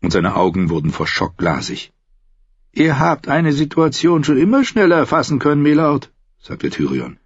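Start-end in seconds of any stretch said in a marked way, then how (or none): 2.7-6.1 higher voiced bitter son, probing his father; a higher, defiant son testing his father; Tyrion's voice. Son asking his father about punishment, higher defiant voice.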